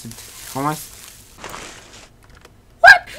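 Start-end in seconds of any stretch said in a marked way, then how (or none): 2.8-3.2 very high loud startled what; shocked squeal. Real, not acted. Player shocked by the game, high squealed what. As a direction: squeak out the word in disbelief.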